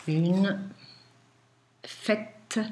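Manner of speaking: slow, word-by-word dictation; dictating. Shown as a demonstration. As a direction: dictate slowly to a class.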